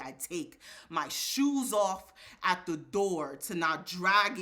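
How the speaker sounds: spoken word poem. Acted. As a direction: hit each line like a drumbeat on stage.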